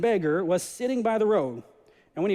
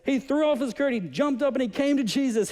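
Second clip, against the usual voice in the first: excited retelling; 6 semitones higher than usual. A narrator's reading. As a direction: tell it like a thrilled kid. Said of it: "He's so excited".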